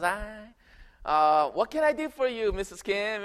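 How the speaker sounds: the neighbor asks Mrs Kim what she needs; mock American neighbor. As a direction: greet her sweetly and curiously. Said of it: "I was".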